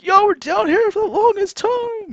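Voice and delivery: very high, loud falsetto; squealed mock protest. Trader complaining to the chart, squeaky mock protest. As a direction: whine it loudly way up in falsetto.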